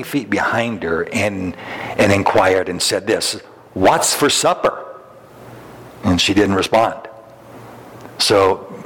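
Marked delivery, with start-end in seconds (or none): 3.7-4.8 calling out. Retold or imitated. Husband calling to wife, far away.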